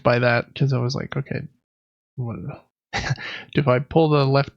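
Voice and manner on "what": normal talking voice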